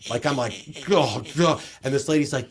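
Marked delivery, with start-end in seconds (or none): 0.9-1.6 loud, frustrated groans; fuming grunts at the checkout. Retold or imitated. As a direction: let out two exasperated grunts. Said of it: "Dude, I am fuming".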